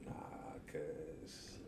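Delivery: low, soft, deadpan reply; understated refusal. Retold or imitated. Retelling a reply to people speaking Spanish to him, understated. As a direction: drop it down low and quiet.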